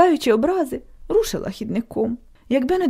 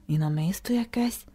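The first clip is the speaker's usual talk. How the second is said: whisper; 3 semitones lower, 7 dB quieter than usual. Acted. Boy says it softly.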